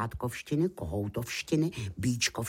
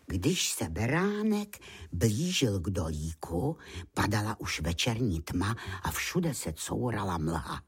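ordinary delivery

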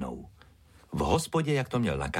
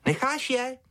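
put-on little girl's high shrill-pitched yell; shouted scolding. A narrator's reading. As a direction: call the dog off sharply in a high child voice. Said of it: "křikla na něj Ája".

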